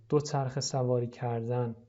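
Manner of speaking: normal talking voice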